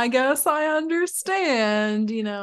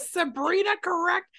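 stern teacher; 8 semitones higher than usual. Pretend. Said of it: "slapping you with the stick".

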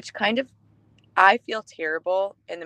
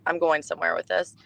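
normal talking voice

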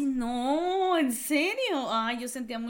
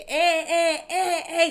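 mocking taunt; 6 semitones higher, 8 dB louder, much slower than usual. Friend taunting her in class.

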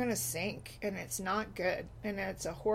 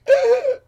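very high, loud laughing shriek; 17 dB louder than usual. Real, not acted. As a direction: squeal with laughter at the memory. Kids' reaction to her ranting.

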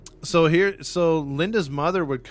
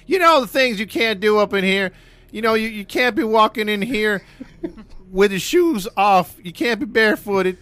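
high, loud mock British landlady; 7 semitones higher, 6 dB louder than usual. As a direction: ham up the house rules. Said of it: "You know the house rules".

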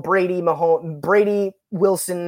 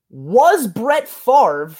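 "was Brett Favre" drawn out very slowly, a bit higher and louder; emphasis on Brett Favre; 4 semitones higher, 4 dB louder, much slower than usual. Shown as a demonstration. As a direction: stretch out "Brett Favre" as a teasing hint. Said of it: "I put special emphasis on that".

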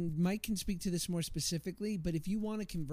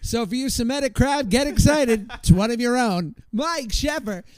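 loud, higher emcee voice introducing Mike; stage announcer; 4 semitones higher, 9 dB louder than usual. Retold or imitated. Introducing Mike to the crowd, he booms like an emcee. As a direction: hype the crowd like a ring announcer. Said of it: "their voices were like this".

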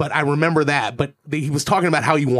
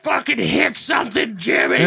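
mock drunken yell; 7 semitones higher than usual. Drunk dad urges on cab driver, mock yell. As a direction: imitate a shout from the back seat.

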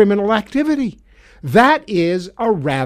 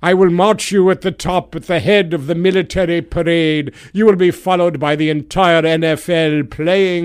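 King Donald. Pretend. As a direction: proclaim it like a pompous tyrant king. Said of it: "I am King Donald".

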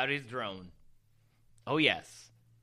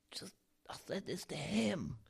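hushed aside; whispering; 4 semitones higher, 8 dB quieter than usual. Real, not acted. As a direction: drop to a whisper. Secretive aside in story.

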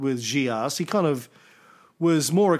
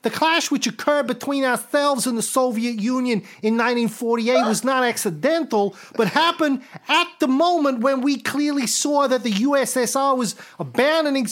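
Tito's quote in a higher, hammed-up tough-guy voice; Joe Pesci; 9 semitones higher than usual. Host reading Tito's quote as a higher mock gangster. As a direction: read the historical quote like a mob movie gangster. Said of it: "I should do this as Joe Pesci".

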